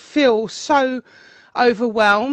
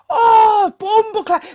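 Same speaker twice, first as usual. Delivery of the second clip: laughing Jamaican patois exclamation, higher and loud; Jamaican patois accent; 8 semitones higher, 5 dB louder, slower than usual. Retold or imitated. Imitating amazed Jamaican friends in patois. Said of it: "patois accents".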